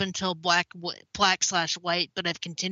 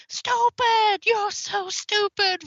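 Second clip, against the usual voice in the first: high, drawn-out yelling; shouted movie quote; 14 semitones higher, 4 dB louder, much slower than usual. Pretend. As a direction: scream the insult slowly. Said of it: "from U H F".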